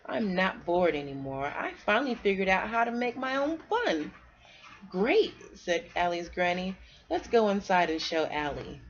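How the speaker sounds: put-on lively read-aloud for kids; storybook reading. A narrator's reading. Parent reading a bedtime story to kids, lively storybook voice. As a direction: read it like a picture book to children.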